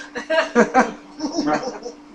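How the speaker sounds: a real laugh at the interruption; amused laugh. Real, not acted. Speaker laughs at a smelly baby nearby, real amused chuckle.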